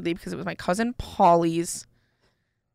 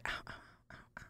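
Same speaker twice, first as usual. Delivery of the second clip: softer, hushed little cries of pain; whispered ows; 26 dB quieter, much faster than usual. Retold or imitated. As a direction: wince in a whisper so nobody notices. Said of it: "we're literally, like, whispering".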